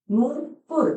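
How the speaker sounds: नूपुर with a long drawn ऊ in नू; careful pronunciation. Shown as a demonstration. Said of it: "pronunciation ये चीज clear करेगा".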